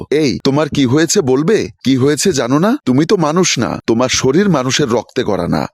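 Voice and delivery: usual speaking voice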